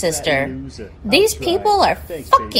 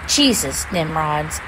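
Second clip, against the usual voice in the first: normal talking voice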